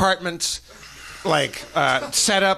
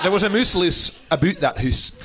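mock Scots with oo vowels; Scottish accent. Faker rhyming on her name, mock Scottish accent. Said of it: "Or as I said, Moose".

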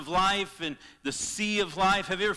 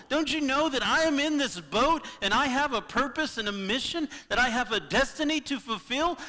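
higher, louder, exasperated scolding; frustrated Jesus; 7 semitones higher, 4 dB louder than usual. Retold or imitated. Jesus scolds fearful disciples in the boat, frustrated and rising. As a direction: rebuke the doubting disciples, voice climbing. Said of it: "he was so frustrated with the disciples".

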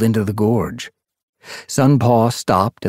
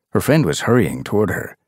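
ordinary delivery

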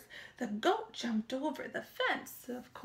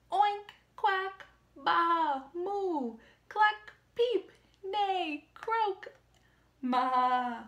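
animal noises; 4 semitones higher, 5 dB louder, much slower than usual. Acted.

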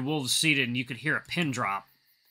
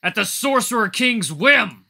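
loud, higher, slow roar; 9 semitones higher, 9 dB louder, slower than usual. Acted. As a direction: roar it in fury. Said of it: "He roars".

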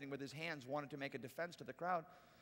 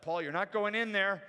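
louder, insistent warning; firm warning; 4 semitones higher, 9 dB louder than usual. Retold or imitated. Disciples stopping Paul entering the theater, firm warning. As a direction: put your foot down to stop him. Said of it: "they're like".

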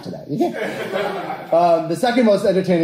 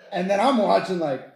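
ordinary delivery

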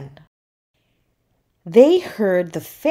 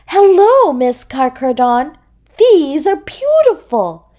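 high, loud delighted greeting; exclaiming; 8 semitones higher, 6 dB louder than usual. A narrator's reading. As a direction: call out admiringly in a high seahorse voice. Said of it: "Cece exclaimed".

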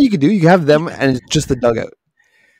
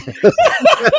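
laughing at own weird accent; helpless high giggle. Real, not acted. Apologizing for a botched accent, high laugh.

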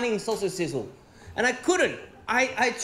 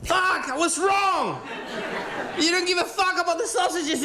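comic outraged shout; 7 semitones higher than usual. Acted. Comedian shouts in mock outrage at the audience. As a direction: bellow the realisation like a betrayal. Outraged punchline about the sausages.

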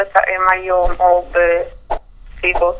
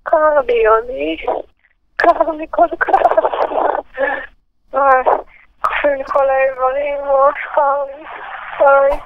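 higher, whiny moaning about the cold; sick and shivering; 5 semitones higher than usual. Acted. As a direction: complain pitifully like you're freezing and aching. (גניחה חולנית ומתלוננת)

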